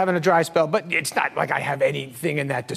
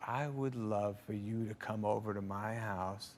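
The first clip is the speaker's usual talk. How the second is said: low, soft, slow mock Ford; Harrison Ford; 4 semitones lower, 8 dB quieter than usual. Harrison Ford inviting the young comic over, quiet and slow. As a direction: drop it quiet and slow, deadpan. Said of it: "He goes".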